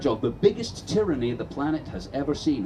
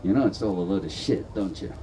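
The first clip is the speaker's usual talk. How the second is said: lower, knowing inner voice; little inner voice; 9 semitones lower than usual. Retold or imitated. A conscience at the back of people's heads, lower and knowing. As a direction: nag like a conscience at the back of the head. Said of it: "there's a little voice going".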